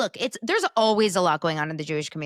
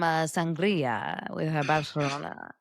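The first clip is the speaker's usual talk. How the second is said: mock Spanish accent; slower than usual. Host hams up a muddled Spanish accent suggesting sangria. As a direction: drag it out in an unplaceable fake Spanish accent. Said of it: "I don't know what this accent is anymore".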